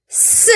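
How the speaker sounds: "S" as its phonic sound, "sss-uh". Shown as a demonstration. Teacher shows T's sound in this syllable, "S" as "suh". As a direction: sound out "S" as a hissed "suh", not "ess". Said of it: "La lettre T ici fait le son".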